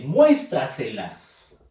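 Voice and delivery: "muéstraselas" drawn out, weight on "mués"; stress on MUÉS. Shown as a demonstration. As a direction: lean slowly on the first syllable, "MUÉS-tra-se-las". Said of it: "Fuerza de voz en mues".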